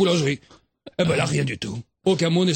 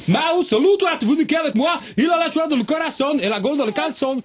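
put-on voix aiguë et criée, accent brésilien exubérant; déborde de joie, à pleine voix; Zouzou exubérant; 9 semitones higher, 3 dB louder than usual. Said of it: "C'est, t'as fini?". (exubérant et criard, façon Copacabana)

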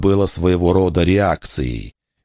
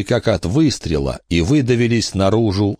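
ordinary delivery

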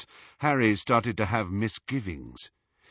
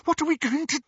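panicked; faster than usual. A narrator's reading. Hermione panicking.

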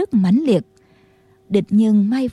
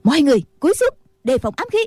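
raised-pitch call to take cover, mildly urgent; urgent warning call; 4 semitones higher, much faster than usual. A narrator's reading. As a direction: call out a contained warning to the group. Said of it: "Nghi Hòa la lớn". (hô cảnh báo, hơi gấp)

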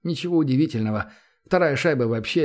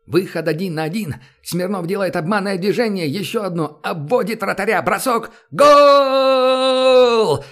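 excited hockey goal call; 7 semitones higher, 7 dB louder, slower than usual. Acted. Commentator calls a goal.

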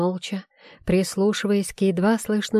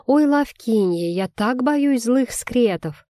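mocking baby talk; 3 semitones higher than usual. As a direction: mimic a whiny little suck-up. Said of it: "Она сощурилась и засюсюкала".

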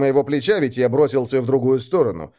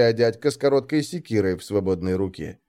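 usual speaking voice

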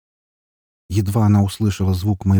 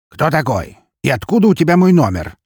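higher, louder curt demand; suspicious challenge; 8 semitones higher, 6 dB louder than usual. A narrator's reading. Man demands of an unknown caller, suspicious and loud.

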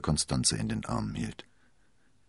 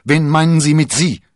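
much higher and louder, alarmed demand; agitated; 12 semitones higher, 14 dB louder than usual. A narrator's reading. Athos to Constanze, alarmed and loud. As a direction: jump up and demand an answer. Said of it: "sprang erregt auf".

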